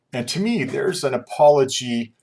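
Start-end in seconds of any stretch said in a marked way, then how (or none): none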